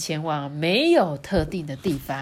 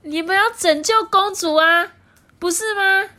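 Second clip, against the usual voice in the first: princess voice; 13 semitones higher, 6 dB louder than usual. Acted. 公主质问.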